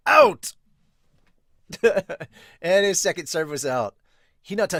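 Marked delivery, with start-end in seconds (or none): 0.1-0.5 very high, loud Out; piercing referee cry; line call. Acted. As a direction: yell the call sharply.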